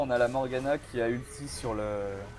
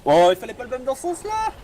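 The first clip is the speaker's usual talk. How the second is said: high, loud exclamation at the play; excited exclamation; 11 semitones higher, 7 dB louder than usual. Real, not acted. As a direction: call it out in surprise. Reacting to a misplay live.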